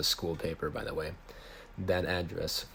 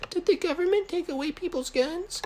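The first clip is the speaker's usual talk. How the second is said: much higher and louder falsetto; Riley's voice; 5 dB louder than usual. A narrator's reading. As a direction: ask it way up high, worried kid. Riley voiced in story dialogue.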